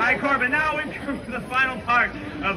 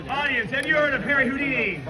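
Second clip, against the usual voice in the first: calling out to crowd; 3 semitones higher than usual. Real, not acted. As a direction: project it to the whole crowd. Performer calling out to audience.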